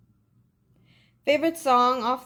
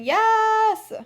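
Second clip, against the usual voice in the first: excited yell; 10 semitones higher, 7 dB louder than usual. Real, not acted. Cheers at [name] wish.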